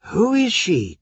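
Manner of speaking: a touch of breathless surprise; gasped. Acted. Paris seeing the image of Helen, mild gasp. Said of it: "gasped Paris".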